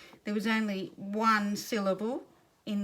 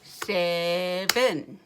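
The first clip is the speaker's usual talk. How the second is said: SEVEN slow and level, two separate syllables; robot talk; 3 dB louder than usual. Shown as a demonstration. Teacher clapping syllables with children, slow robot SEVEN in two chunks. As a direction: chunk SEVEN like a robot, one beat per clap. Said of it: "Say it like a robot".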